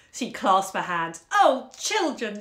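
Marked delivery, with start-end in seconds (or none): 1.3-2.4 slow, louder, higher exclaim; overflowing with delight; mother moved by her children, crying out; touched cry. Acted. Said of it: "she cried".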